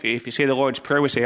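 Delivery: normal talking voice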